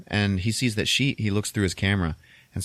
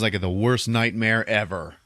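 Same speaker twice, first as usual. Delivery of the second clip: normal talking voice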